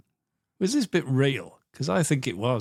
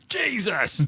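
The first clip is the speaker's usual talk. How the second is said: high-pitched shocked exclamation; hammed-up jump-scare cry; mock startled reaction; 10 semitones higher than usual. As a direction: blurt it like you've had a fright, playing it up. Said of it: "his reaction is".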